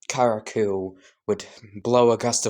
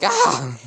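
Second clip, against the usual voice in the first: short, louder startled yelp; 3 semitones higher, 5 dB louder than usual. Acted. Deku startled by the spider boy, yelp. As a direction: jump back with a yelp. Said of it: "screaming a bit".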